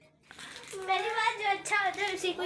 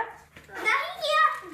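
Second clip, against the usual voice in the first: higher, louder, slow drawn-out; 7 semitones higher, 6 dB louder, much slower than usual. Real, not acted. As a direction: boss the viewers around. Child mock threat to viewers.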